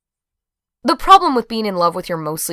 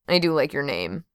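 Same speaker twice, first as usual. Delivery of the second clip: softly mumbled admission; 2 semitones lower, 7 dB quieter than usual. A narrator's reading. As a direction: mumble it, a little under the voice. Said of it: "she mumbled".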